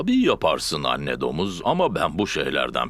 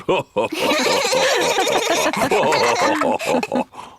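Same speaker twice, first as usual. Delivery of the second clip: a much higher, ringing belly laugh; hearty laugh; 3 dB louder than usual. Acted. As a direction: laugh it off with delight. Daddy Pig laughs off the mud. (çamura bulanmışken kahkahayla gülüyor)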